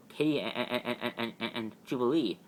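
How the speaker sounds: ordinary talk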